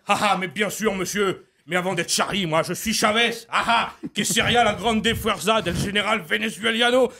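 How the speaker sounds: high, fiery tribune's voice; Mélenchon impression. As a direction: orate like a firebrand politician. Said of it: "Jean-Luc Mélenchon".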